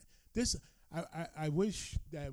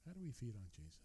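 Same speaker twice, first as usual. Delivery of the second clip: "How do we feed on Jesus?" repeated much softer, almost to himself; quiet repeat; 4 semitones lower, 13 dB quieter, much faster than usual. Shown as a demonstration. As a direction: let the repeated question drop to a hush.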